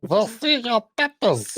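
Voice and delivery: high, slow cartoon German villain accent; Indiana Jones German accent. Host mimicking film Germans with stupid accents, cartoon German accent. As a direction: ham up a movie Nazi's German accent. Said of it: "Indiana Jones accent".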